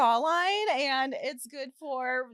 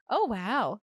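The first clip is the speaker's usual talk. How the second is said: mock impressed; 4 semitones lower, much slower than usual. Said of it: "she always makes fun of me".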